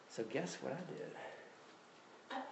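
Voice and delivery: slow, breathy conspiratorial whisper; a slow hushed secret. Acted. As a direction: lean in and whisper the confession slowly. Confessing to the congregation.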